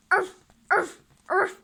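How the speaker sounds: high loud yapping barks; dog barking. Acted. Dog warning off the stranger, loud barks.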